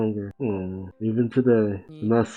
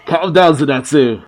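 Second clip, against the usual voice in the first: loud shout; 5 semitones higher, 9 dB louder than usual. Acted. Shouting at a panicking friend.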